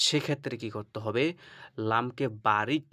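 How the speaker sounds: normal talking voice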